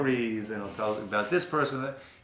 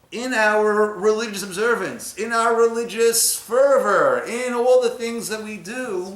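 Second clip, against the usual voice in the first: rising fervor; 9 semitones higher, 6 dB louder than usual. Real, not acted. Preaching rise to fervor.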